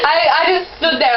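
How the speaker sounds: coughing fit. Real, not acted. Coughing and laughing after challenge.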